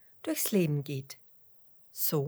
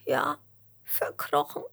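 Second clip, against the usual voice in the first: high, breath-broken tearful worm; gespieltes Schluchzen; 9 semitones higher than usual. A narrator's reading. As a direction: answer as a small worm sobbing. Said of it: "schluchzt der Regenwurm". (hohe, weinerliche Wurmstimme)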